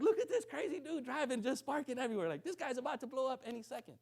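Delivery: high-pitched mock disbelief; amazed onlookers. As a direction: imitate astonished spectators, pitched well up. Imagined onlookers gawking, laughing at him.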